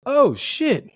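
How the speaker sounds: high, swooping exclamation of surprise; surprised reaction. Retold or imitated. Recounting spotting someone unexpected at a bar, surprised. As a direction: let it leap up in mock astonishment.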